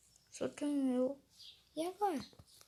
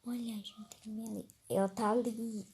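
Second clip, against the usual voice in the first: ordinary talk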